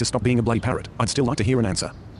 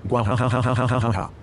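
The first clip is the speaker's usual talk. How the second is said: villain laugh. Acted. Gloating over rival synth.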